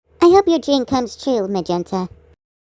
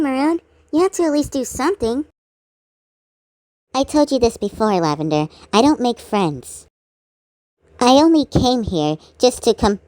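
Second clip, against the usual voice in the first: normal talking voice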